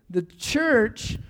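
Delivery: ordinary delivery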